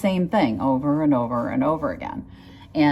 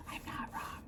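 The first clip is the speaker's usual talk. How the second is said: whispered aside; 23 dB quieter than usual. Real, not acted. Cheeky aside after I could be wrong.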